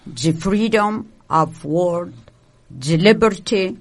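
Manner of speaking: ordinary talk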